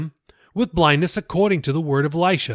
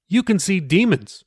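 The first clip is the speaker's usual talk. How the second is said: a touch higher, earnest explaining pastor; lightly voiced pastor; 6 semitones higher than usual. Pastor patiently explaining scripture to Jacob, lightly raised voice. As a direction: gently lift the voice for the pastor.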